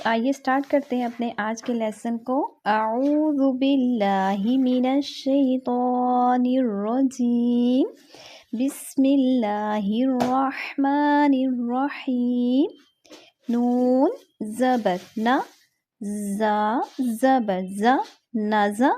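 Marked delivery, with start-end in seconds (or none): 2.6-12.7 slow, drawn-out Quranic recitation. Real, not acted. Teacher opening the lesson with a slow Quranic recitation. 13.5-18.9 slow, syllable-by-syllable Qaida spelling; slow spelling drill. Shown as a demonstration. Teacher drilling students in slow Qaida spelling. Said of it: "پہلے ہم پڑھتے تھے".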